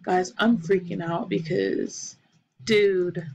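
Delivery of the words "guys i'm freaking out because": ordinary talk, nothing marked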